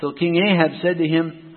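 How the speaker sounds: ordinary talk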